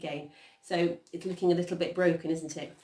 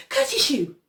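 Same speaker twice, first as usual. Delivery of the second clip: high, loud mock sneeze; acted sneeze; 8 semitones higher, 10 dB louder than usual. A narrator's reading. Peter hiding in the tool shed, acted sneeze. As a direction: sneeze it out as a word, suddenly louder. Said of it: "Peter sneezed".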